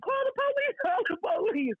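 high-pitched imitation of frightened cries for help; mock panic. Scared housemates wanting the police, mock panic. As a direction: cry out in a put-on high voice.

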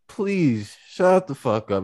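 slow "Please shut the fuck up", "Please" leading; slow sincere request. Shown as a demonstration. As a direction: ask it slowly, almost kindly. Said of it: "it's more so like, "I hear you".

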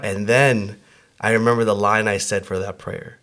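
normal talking voice

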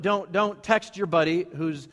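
ordinary talk

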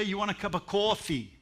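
high, far-off mock Boston accent on You want a cup of coffee; doing a Boston local asking about coffee. Preacher mimics Boston locals, in a mock Boston accent. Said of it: "And you know what they say?".

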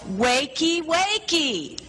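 high yell. Retold or imitated. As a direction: belt it out the second time. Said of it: "And she yelled".

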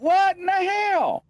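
high, slow alarmed "what in the hell"; startled outcry. Retold or imitated. Hearing his mom scream outside, a high startled outcry. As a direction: jump at the sudden scream.

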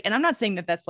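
ordinary talk, nothing marked

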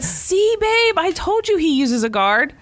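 gloating. Retold or imitated. Boyfriend gloating after barber confirms.

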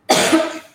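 sharp single cough; a short dry cough into the mic. Real, not acted. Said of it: "Peço desculpa pela tosse".

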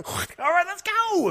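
put-on rally yourself like a party kid; hyped up. Nineteen and buzzed, hyping the trip, excited high voice. Retells hyped morning before Dachau.

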